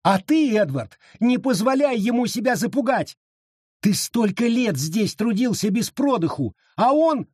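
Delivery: very high, impassioned woman; heated urging. A narrator's reading. Abita urges Edward to stand up, impassioned. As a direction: urge him on until cut off.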